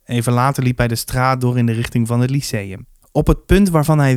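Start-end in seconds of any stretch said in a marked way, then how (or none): none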